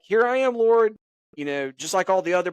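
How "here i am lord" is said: high, loud eager offer; eager Moses. Retold or imitated. Moses eagerly answering God's call, high and loud. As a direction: jump up and volunteer. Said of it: "he is like".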